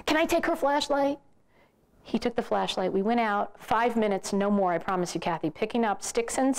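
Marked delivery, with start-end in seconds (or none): 0.1-1.2 put-on high-pitched little boy asking; four-year-old son. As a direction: mimic a small child's pleading question, pitched well up. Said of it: "I saw my four-year-old son sitting there".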